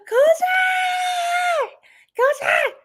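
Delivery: high-pitched excited squeal; excited cry. Pretend. Tarot reader reacting to chills mid-reading, excited high cry. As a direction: cry it out high after a gasp. Gleeful outburst during Cancer reading.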